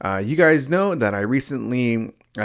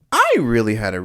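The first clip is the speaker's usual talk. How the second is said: real pubescent-style voice crack; 2 semitones higher, 6 dB louder, much faster than usual. Real, not acted. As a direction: voice jumps up and breaks into laughter. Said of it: "It changed my voice".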